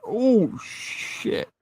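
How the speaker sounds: high oh, then a whispered shit; shocked realization. Retold or imitated. Fan retelling his shock at the scene, high oh then whispered shit. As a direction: caught off guard. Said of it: "And I'm like".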